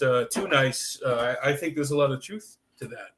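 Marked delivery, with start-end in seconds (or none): none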